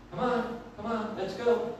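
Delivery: softly grumbled come on, under the breath; impatient muttering. Retold or imitated. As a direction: mutter it to yourself, impatient in the car. Impatient driver muttering at hesitant driver.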